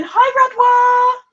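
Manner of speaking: high, loud, drawn-out hello; excited greeting. Real, not acted. Greeting the prize winner in chat, high and excited. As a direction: sing out her name, delighted.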